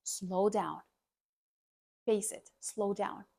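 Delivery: deliberate, hushed slow-down; slowed for emphasis. Shown as a demonstration. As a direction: ease right down to make the point. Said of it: "If you want to emphasize".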